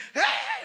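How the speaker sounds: very high loud yelp of disgust; mock disgusted yelp; disgusted recoil. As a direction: recoil with a squeal.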